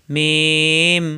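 মিম drawn out into a long hum; lips closing again. Shown as a demonstration. Teacher demonstrating lip closure, drawn-out articulation. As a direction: seal the lips slowly at both ends of মিম. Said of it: "আবার মিলাতে হবে".

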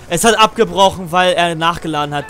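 loud, annoyed complaint; frustrated outburst. Real, not acted. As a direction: vent at the game. Frustrated gamer rant.

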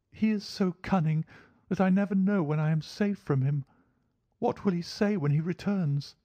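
very high, anxious feminine voice; worried woman dreading his return; frightened lady client. Acted. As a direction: fret fearfully, pitched right up.

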